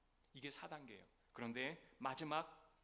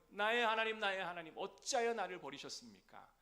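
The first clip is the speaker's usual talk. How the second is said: the same cry again, a little less loud, high; repeated cry; 6 semitones higher, 8 dB louder than usual. Acted. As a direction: call out to God once more, still pained. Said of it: "뭐라고요?".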